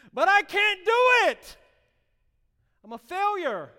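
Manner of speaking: high, loud wailing cry; sobbing loud despair; anguished high cry to God. Retold or imitated. Retold crying prayer to God.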